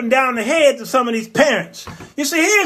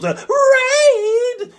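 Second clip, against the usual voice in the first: high drawn-out ad jingle voice; mock commercial announcer, much higher; 14 semitones higher than usual. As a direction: sing the brand name like a TV ad. Imitating Raid commercial.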